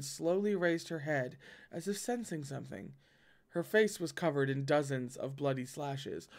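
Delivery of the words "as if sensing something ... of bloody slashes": plain reading voice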